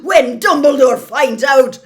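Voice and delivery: furious threat. Acted. McGonagall threatening the Minister, shouting angrily. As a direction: fling the threat at him.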